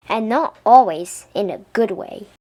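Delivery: ordinary delivery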